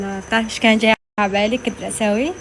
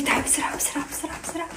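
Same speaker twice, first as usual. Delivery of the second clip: hushed, breathy whisper of his hurry-up call; whispered imitation; 4 semitones higher, 11 dB quieter, faster than usual. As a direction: whisper it like a secret complaint. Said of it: "عم يقول لي". (همس بتقليد زوجها وهو يستعجلها)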